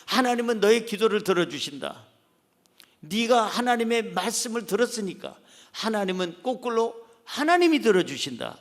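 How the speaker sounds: ordinary talk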